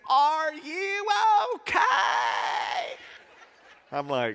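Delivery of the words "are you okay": high, drawn-out yell of concern; calling out. Retold or imitated. Woman gardener calling to a sick passerby, calling out. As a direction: stretch it out and end on a loud holler. Retold woman calls out to him.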